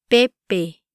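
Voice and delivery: "Pepe" in two measured syllables; careful pronunciation demo. Shown as a demonstration. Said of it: "try pronouncing these words".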